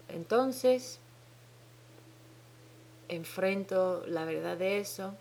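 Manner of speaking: usual speaking voice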